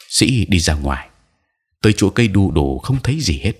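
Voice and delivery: ordinary delivery, nothing marked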